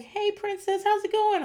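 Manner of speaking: high, gentle caretaker voice; sweet nurse voice. Pretend. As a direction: coo it like a kind nurse. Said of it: "They'll be like".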